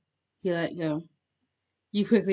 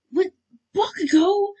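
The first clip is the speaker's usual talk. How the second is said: high, loud startled question; startled realization; 12 semitones higher, 6 dB louder, slower than usual. A narrator's reading. Grabbed listener realizing who it was, startled. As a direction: blurt it out in surprise. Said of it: "realizing who it was".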